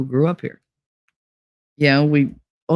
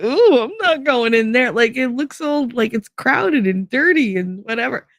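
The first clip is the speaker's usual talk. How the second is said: a light mock of a snooty New Yorker turning up her nose; hint of a prissy city girl scoffing at a dive; lightly voiced disgusted New Yorker; 7 semitones higher than usual. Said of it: "the New Yorkers would look and be like".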